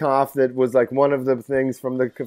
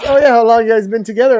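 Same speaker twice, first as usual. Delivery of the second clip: mock eager host; 8 semitones higher, 5 dB louder than usual. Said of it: "he goes".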